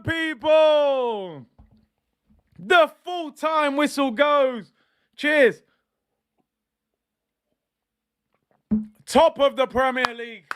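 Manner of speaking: milk every word at full time; triumphant announcement. Real, not acted. Streamer announces the final whistle, triumphant and drawn out.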